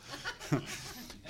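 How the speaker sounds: breathy real laugh after the demo; amused laugh. Real, not acted. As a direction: let a true laugh slip out.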